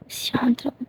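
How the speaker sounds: soft breathy hush, no voice; calm her with a whispered order. Acted. Bhumi hushing Geeta with a finger on her lips, whispered.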